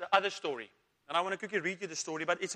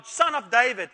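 louder shout; 7 dB louder than usual. A narrator's reading. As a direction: call out louder, pleading. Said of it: "he only shouted louder".

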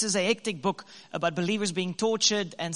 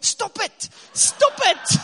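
high, laughing plea rising to a shout; pleading to stop; 13 semitones higher, 7 dB louder, slower than usual. Retold or imitated. Husband begging crying wife in cafe, high laughing shout. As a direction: beg her to stop with a laugh. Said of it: "I say to her".

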